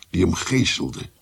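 ordinary talk, nothing marked

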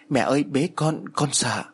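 slow, lightly voiced child calling for mother; mildly acted frightened, tearful child. A narrator's reading. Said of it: "Mít khóc lóc gọi mẹ". (giọng trẻ gọi mẹ, hơi mếu máo)

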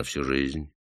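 тише, весомо и спокойно; скажи тихо, но с весом; тихо и важно. Acted. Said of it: "ответил тихо, но важно". (рабочий тихо и важно отвечает парню)

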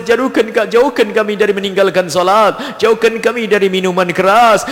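chanted du'a, steadily building in fervour; closing prayer. Acted. Imam leading the gathering in closing du'a, fervent prayer voice. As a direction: lead the congregation in earnest supplication, each plea ending ya Allah.

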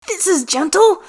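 outraged disbelief at the pain; incredulous. A narrator's reading. Gale, incredulous during stretch.

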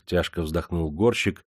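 plain reading voice

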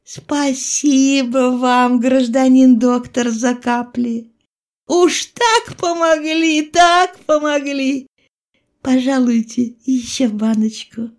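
high, drawn-out wheedling peasant woman; butter up the doctor in a sugary singsong; slow, high, ingratiating thanks; flattering singsong. A narrator's reading. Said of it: "и запела льстиво".

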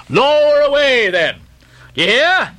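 high shouted order; shouting. A narrator's reading. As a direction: shout it across the deck. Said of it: "shouting across the deck".

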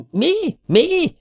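very high squealing falsetto; a pompous man losing it in falsetto; shrill falsetto squeal. A narrator's reading. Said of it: "visított magas fejhangon".

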